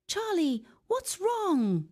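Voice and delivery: high, small brave fish voice; brave little fish. Acted. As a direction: ask bravely in a tiny high voice. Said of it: "Timothy bravely asks".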